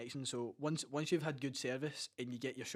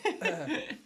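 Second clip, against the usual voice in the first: high, loud laugh into a yes; laughing out the agreement; real laughter; 11 semitones higher, 6 dB louder than usual. Real, not acted. Laughing along at the joke about the keeper.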